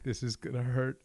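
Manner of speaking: words shaken by laughter; amused, retold resignation to a beating; laughing dread. Recalling the boxer's coming revenge, laughing.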